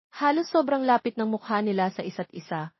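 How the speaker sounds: plain reading voice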